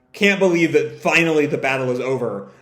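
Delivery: ordinary delivery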